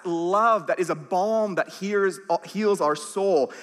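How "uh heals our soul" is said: usual speaking voice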